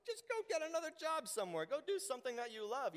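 high, breezy know-it-all; high mocking advice voice. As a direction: toss off the advice, high and glib.

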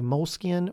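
"Moleskine" said as mole-skin; pronunciation variant. Shown as a demonstration. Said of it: "I don't even know if you say".

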